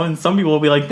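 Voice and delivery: ordinary talk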